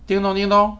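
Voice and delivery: high, loud sing-song call; calling wake word. Real, not acted. Wake word called to speaker.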